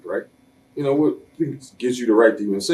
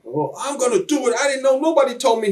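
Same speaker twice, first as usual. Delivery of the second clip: much higher defensive excuse voice; mock Vince. Wrestler mocking Vince's excuse, high innocent voice. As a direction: pitch it way up, playing innocent.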